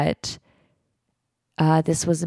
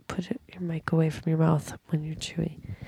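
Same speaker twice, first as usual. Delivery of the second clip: whispered aside, much softer; hushed scolding; 9 dB quieter than usual. Real, not acted. Telling someone chewing to move the mic, hushed scolding. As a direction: whisper a quick correction.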